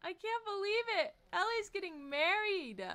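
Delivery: happy high exclaim; excited. Real, not acted. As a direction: burst out with glee. Wedding excitement.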